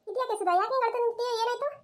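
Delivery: high-pitched startled questioning; worried surprise, pitched well up; alarmed concern. Acted. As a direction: ask a crying friend what happened, voice shooting up. Newcomer finds friend crying.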